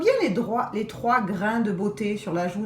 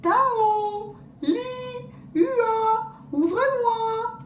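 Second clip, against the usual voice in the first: wolf calling as grandmother; much slower than usual. Acted. Wolf imitating grandmother's voice to the girls, high call. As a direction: call sweetly through the door, faking granny. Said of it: "Il éclaircit la voie et crie".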